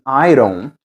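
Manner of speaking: I don't with the d flapped, said like ai ro. Shown as a demonstration. Teacher showing the relaxed flapped D in don't. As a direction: tap the D in don't lightly, like ai ro want to drink. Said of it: "pronunciado como um rareriroru".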